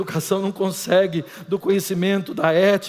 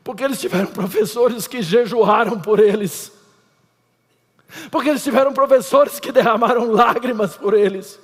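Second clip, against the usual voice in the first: building, louder and higher repetition; rising preaching anaphora for the congregation; 6 semitones higher, 6 dB louder than usual. Acted. Anáfora crescente de pregação. (pregação crescente, cada vez mais alta)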